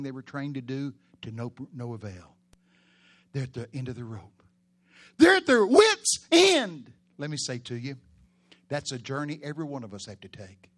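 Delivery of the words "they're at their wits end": slow, much higher, loud proclamation; shouted. Acted. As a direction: hammer it home slowly at full volume. Preacher repeats the key phrase.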